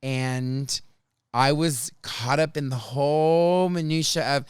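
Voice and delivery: ordinary delivery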